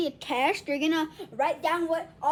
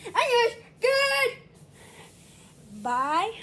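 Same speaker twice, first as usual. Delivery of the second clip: shouted outro; loud goodbye; 6 semitones higher, 7 dB louder, slower than usual. Real, not acted. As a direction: yell the sign-off.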